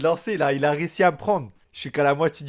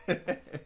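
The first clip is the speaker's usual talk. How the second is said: breathy laugh; 3 semitones higher, 8 dB quieter than usual. Real, not acted. Laughs at the Russian intro.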